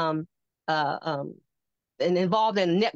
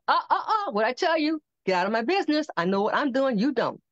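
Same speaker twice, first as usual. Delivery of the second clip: scolding; 6 semitones higher than usual. Retold or imitated. God scolding, retold.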